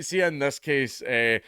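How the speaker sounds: usual speaking voice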